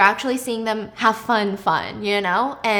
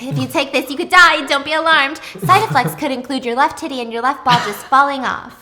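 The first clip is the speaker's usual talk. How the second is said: mock upbeat pharmaceutical ad voice, louder; hammed-up announcer cheerfully listing side effects; ad voiceover; 4 semitones higher, 7 dB louder than usual. Mocking a medication ad's cheery voiceover, louder. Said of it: "And there's like".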